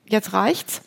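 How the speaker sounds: ordinary talk, nothing marked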